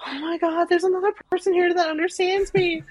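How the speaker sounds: high, loud gush of relief; acted-out overjoyed outburst; excited relief. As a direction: gush like you found your own people. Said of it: "like, this relief and this".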